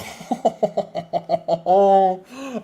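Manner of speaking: loud, high burst of laughter; relieved laugh. Real, not acted. Fan laughing after the header just misses, loud. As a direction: laugh out the near miss.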